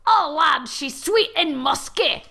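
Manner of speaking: a hint of a Scottish gnome, slowed; lightly put-on Scottish brogue. Heath Ledger gnome sniffing the panties, lightly put-on Scottish brogue. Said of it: "Heath Ledger gnome ... says".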